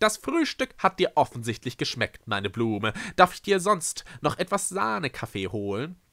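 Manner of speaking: lifted, delighted gushing. A narrator's reading. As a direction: fuss over a guest with pleased enthusiasm. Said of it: "rief Eispin entzückt".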